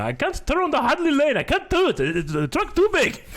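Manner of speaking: badly faked Indian accent of the truck driver; mock Indian accent. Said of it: "I can't do the accent, but he's just like".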